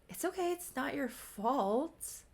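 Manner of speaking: high, soft, drawn-out mock reassurance; confused reassurance. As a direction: reassure haltingly, pitched high and unsure. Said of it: "He's so confused".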